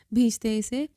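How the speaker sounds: ordinary delivery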